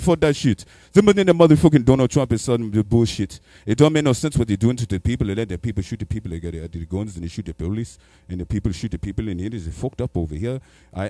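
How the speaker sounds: put-on an African accent, angry then resigned; a light African accent, ranting. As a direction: rant like an exasperated African driver. African Uber driver voiced.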